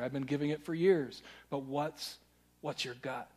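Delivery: ordinary talk, nothing marked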